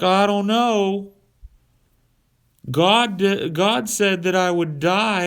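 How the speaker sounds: high, slow, unsure doofus; dopey hesitant Adam. Retold or imitated. Adam hesitating before the snake, high and slow. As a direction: drag the worry out slowly.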